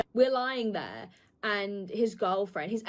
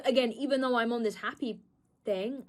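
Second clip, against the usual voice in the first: ordinary delivery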